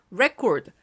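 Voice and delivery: "record" as a noun, REC-ord; stress on first syllable. Shown as a demonstration. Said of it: "강세가 앞에 찍으면 명사야". ("record"의 앞 음절에 강세)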